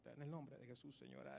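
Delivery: very soft, low mumbled prayer; hushed prayer. Retold or imitated. Nervous helpers starting to pray, hushed and low. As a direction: pray under your breath, barely voiced. Acting out timid group prayer.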